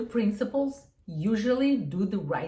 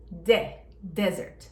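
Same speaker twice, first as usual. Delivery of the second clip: De destacado, depois Desert com tônica no de; stressed first syllable; 3 semitones lower, 3 dB louder, much slower than usual. Shown as a demonstration. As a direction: punch De then say Desert. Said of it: "E você tem um S só". (ênfase forte no De de Desert)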